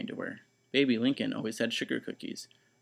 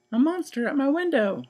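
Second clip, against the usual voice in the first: 1 frightened shout; 7 dB louder than usual. Acted. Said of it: "shouted Baby".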